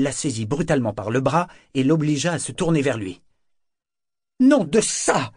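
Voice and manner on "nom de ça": loud, high-pitched outburst; indignant cry. A narrator's reading. Althea seized by the arm, crying out indignantly. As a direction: cry out with no effort to keep it down. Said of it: "s'écria-t-elle sans faire le moindre effort pour parler bas".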